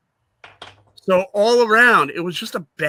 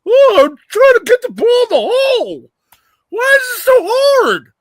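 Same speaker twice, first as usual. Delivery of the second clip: very high, loud, hammed-up whining; mock crybaby wail; 7 dB louder than usual. Host mocking a frustrated owner at a carnival game, loud whiny wail. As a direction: wail like a big baby losing a carnival game.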